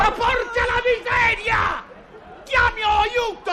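very high, drawn-out wail of rage; slow, high-pitched frantic cry; desperate shout. Acted. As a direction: scream for help, patience gone. Loses temper, trapped in lift.

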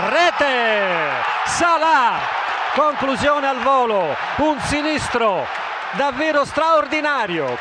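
shouted goal call; breathless Italian goal commentary. Real, not acted. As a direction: roar the scorer's name.

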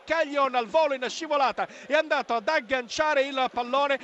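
ordinary talk

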